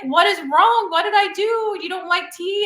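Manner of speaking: startled, anxious questions to a crying wife; alarmed husband. Retold or imitated. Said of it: "And he's like".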